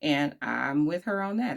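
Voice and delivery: normal talking voice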